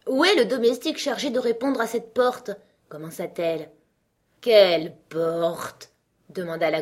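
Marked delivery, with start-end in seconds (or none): 0.1-2.5 higher, mildly indignant demand; ready to scold. A narrator's reading. Alice demands the servant for the door, mildly scolding. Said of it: "prête à réprimander la première personne". 4.4-5.9 very slow, loud, drawn-out croaky frog; slow drawling frog. A narrator's reading. Frog questions Alice, in a very slow drawl. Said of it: "Elle parlait si lentement, d'une voix si traînante".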